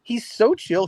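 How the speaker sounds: gushing excited. Retold or imitated. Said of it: "And I was like".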